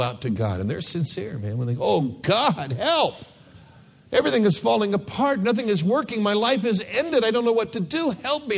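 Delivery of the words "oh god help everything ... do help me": high, pleading cry to God; mock desperate plea, high and fast; desperate crisis prayer. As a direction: beg God for help, frantic and high-pitched.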